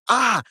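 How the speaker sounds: high, sharp pained ah. Retold or imitated. Mate listening for his pain, high pained ah. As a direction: yelp the ah as it pinches. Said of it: "to hear me go".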